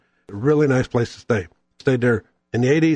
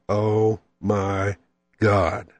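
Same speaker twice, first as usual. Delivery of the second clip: slow, drawn-out, softer oh my God; savoring awe; 5 semitones lower, 3 dB quieter, much slower than usual. Real, not acted. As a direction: melt over the first bite. First bite of great enchilada.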